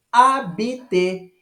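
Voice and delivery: "habiter" slowly as abete, no h sound; silent h. Shown as a demonstration. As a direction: drop the h in "habiter". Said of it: "eu não pronuncio o som do H".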